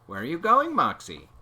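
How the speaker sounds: calling out. A narrator's reading. Teacher calls out to Moxie.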